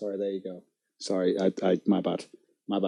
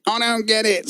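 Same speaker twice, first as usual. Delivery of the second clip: a higher, half-formed try at his whine; mocking the rival streamer; 7 semitones higher than usual. Streamer warming up his impression, mock whiny and high. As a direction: test the rival's hurt tone. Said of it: "I'm gonna try and do like him".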